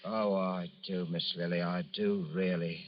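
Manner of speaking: slow, soft and halting; shy, tender hesitation. Acted. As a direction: hardly dare to ask her. Shy man trying to propose.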